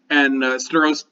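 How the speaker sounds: ordinary talk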